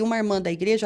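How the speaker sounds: ordinary talk, nothing marked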